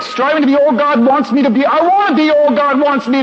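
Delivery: Striving to be all God wants me to be read high and eager. Shown as a demonstration. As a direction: sell it like a rallying cry. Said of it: "yes. That's right".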